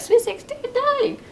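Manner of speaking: high, incredulous exclamation; amazed at the party; surprised. Real, not acted. Reacting to a wild party.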